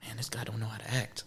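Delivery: fast hushed whisper, dismissive; whispered aside. Retold or imitated. Viewer whispering a put-down of a bad actor, fast hushed whisper. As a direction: mutter it under your breath quickly. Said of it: "you're just like".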